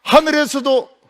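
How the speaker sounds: high, loud call of 하늘에서도; excited call. A narrator's reading. Telling the children the sky itself rang, a high excited call. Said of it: "발자국 소리가 들리는 거예요".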